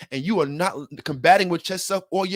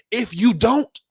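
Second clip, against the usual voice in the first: defiant; 8 semitones higher, 6 dB louder, slower than usual. Real, not acted. Quoting own defiant thought.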